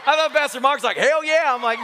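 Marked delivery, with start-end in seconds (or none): 1.0-1.5 high, loud imitated holler; raised, punchy mock cheer; mock exuberant cheer. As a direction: shout it like an over-keen congregant. Imitates what he misheard, loudly.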